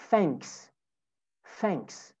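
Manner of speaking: "Thanks" with an F sound at the start. Shown as a demonstration. Teacher shows students the easier sound, "Thanks" with F for TH. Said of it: "sesini F ile değiştirebilirsiniz".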